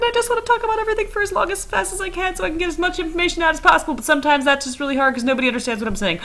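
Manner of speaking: very fast, high and excited; rushing. Shown as a demonstration. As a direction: race through it without breathing. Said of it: "I would start rushing".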